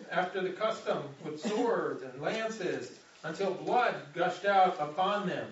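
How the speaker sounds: ordinary delivery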